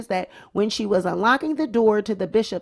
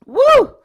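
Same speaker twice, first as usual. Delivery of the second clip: high, loud whoop; releasing a whoop; whooping exhale; 12 semitones higher, 9 dB louder than usual. Real, not acted. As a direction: blow off the laugh with a whoop. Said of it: "let me get it out".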